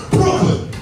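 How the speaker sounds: BROOKLYN popped out like a shot; high, sudden burst on Brooklyn; gunshot-like word. Shown as a demonstration. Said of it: "Sound like you just shot somebody".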